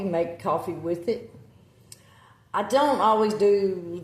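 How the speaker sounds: normal talking voice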